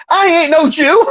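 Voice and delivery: high blustering Archie denial. Retold or imitated. Archie to Lionel, high mock-defensive. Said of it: "he's like".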